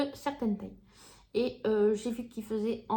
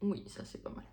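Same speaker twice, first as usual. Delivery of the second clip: whisper; 12 dB quieter than usual. Real, not acted. Unvoiced aside.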